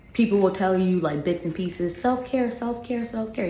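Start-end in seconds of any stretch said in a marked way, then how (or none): 2.0-3.5 high, singsong mock advice; mocking parroting. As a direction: parrot a tired slogan, high-pitched. Mocking stock advice people give.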